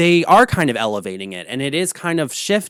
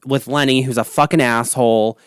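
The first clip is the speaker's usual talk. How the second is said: ordinary delivery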